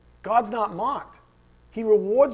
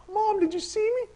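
put-on very high child's voice; excited little kid; 13 semitones higher than usual. Little kid calling to Mom at the finish line, excited. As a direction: pipe up like a kid showing off. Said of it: "then he comes across the line".

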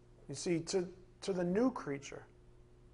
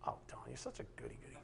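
mock aside; 6 semitones lower, 19 dB quieter, much faster than usual. Mocking himself as goody-goody.

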